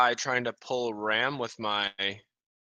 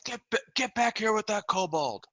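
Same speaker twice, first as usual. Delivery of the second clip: high-pitched mock yell; in-character yell; 8 semitones higher than usual. Aldo yells after the one with the kobold, high mock yell. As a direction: call after them in a high, raised voice without real volume. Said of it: "And I yell".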